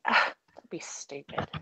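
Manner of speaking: hushed unvoiced whisper, dismissive; whispered retort. Retelling friends' teasing, whispers back dismissively. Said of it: "I was like".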